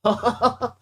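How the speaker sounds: usual speaking voice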